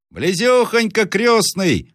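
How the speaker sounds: put-on high boy's voice calling down; мальчик с колокольни. A narrator's reading. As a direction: call back from high up. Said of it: "отвечал с колокольни мальчик". (звонкий крик мальчишки)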